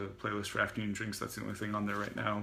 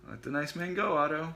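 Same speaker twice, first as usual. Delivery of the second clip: higher patient coaxing; lightly voiced gentle coaxing; 7 semitones higher, 3 dB louder than usual. Zen prying Otto off the man, coaxing. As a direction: coax him like a patient sibling. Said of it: "said Zen".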